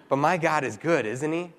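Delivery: ordinary talk, nothing marked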